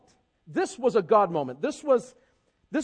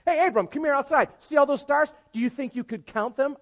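higher, louder teasing God; God with attitude; 6 semitones higher, 5 dB louder than usual. Retold or imitated. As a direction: tease him with a challenge. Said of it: "God's got a little attitude going".

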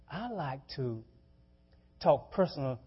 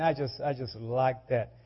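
usual speaking voice

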